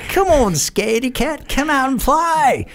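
taunting madman. Retold or imitated. Crazy vet taunting from shadows.

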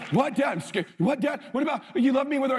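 put-on high, whiny frightened kid; scared little boy. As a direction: imitate a scared son pleading. Voicing scared son.

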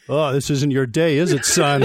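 a hint of a patronizing cop; fatherly cop. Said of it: "the cop turns to me and he says".

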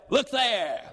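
high, sudden call to look; diversionary exclamation. Acted. Caught in the cookie jar, diverting attention, high exclamation. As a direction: exclaim it to draw their eyes away.

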